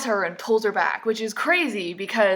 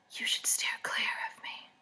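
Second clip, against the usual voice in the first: hushed voiceless warning; whisper; 12 dB quieter than usual. Acted. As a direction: whisper it low-key, all breath. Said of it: "And he whispers".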